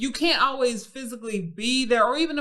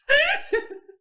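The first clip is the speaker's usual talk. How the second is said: high cackle; 7 dB louder than usual. Real, not acted. Laughing after the put-on voice.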